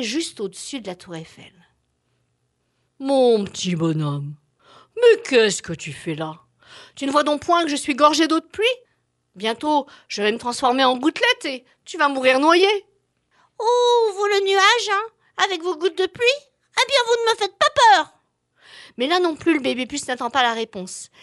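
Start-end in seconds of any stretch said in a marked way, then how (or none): 3.0-12.7 a heavy storm cloud warning. Acted. Dark cloud threatens. 13.6-18.1 high, loud cheeky little voice; a brave tiny flea, defiant; baby flea voice. Acted. Flea defies the cloud.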